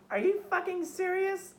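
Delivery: high-pitched mock disbelief; indignant girl. Storyteller mimicking her reaction to being kicked out, high mock indignation. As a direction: imitate her in a high, incredulous voice.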